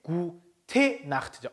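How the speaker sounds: gute said slowly without final n; gute not guten. Shown as a demonstration. Teacher correcting guten to gute, slow and deliberate. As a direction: stress the short gute. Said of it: "guten Nacht가 아니고".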